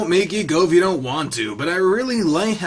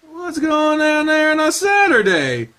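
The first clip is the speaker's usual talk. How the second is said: mother's voice; 12 semitones higher, 3 dB louder than usual. Acted. Mother figure voiced, high and slow.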